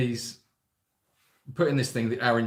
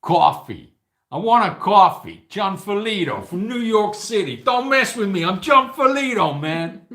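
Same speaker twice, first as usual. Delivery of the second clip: loud, much higher mock New York tough guy; hammed-up New York accent, higher and louder; 7 semitones higher, 7 dB louder than usual. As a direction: play a brash New Yorker who won't be messed with. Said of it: "a real New York name".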